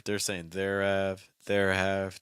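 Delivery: "there have" slowly, with an added vowel; extra vowel added. Shown as a demonstration. Teacher shows students' mistake, "there have" uncontracted. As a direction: stretch "there have" into separate, fuller syllables. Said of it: "adding an extra vowel in there".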